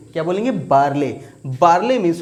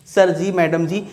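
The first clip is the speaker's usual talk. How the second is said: ordinary talk, nothing marked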